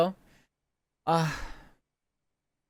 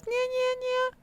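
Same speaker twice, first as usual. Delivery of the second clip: put-on very high, slow cat-girl nyas; squeaky anime cat meow-talk; cutesy nya speak; slower than usual. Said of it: "Want me to nya?".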